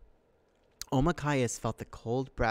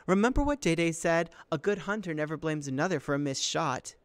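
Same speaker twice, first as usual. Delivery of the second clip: higher, pointed sisterly lecture; scolding reminder; 5 semitones higher than usual. Acted. Sister reminds brother what good hunters do, scolding.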